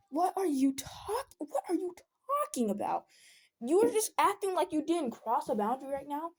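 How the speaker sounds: high, incredulous retold protest; indignant retort. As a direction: stretch the question out in high disbelief.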